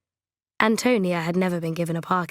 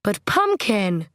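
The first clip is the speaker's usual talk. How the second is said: alarmed plea; 3 dB louder, much slower than usual. A narrator's reading. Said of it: "His expression changed to one of alarm".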